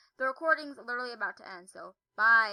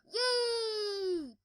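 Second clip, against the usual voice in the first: excited cheer; 7 semitones higher than usual. Real, not acted. Cheer in game.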